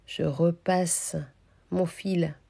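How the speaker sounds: slow, soft step-by-step telling; slowed down so they can follow; slow-motion demo. Shown as a demonstration. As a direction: draw each word out as you show the move. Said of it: "j'ai fait un ralenti pour que ce soit plus visuel".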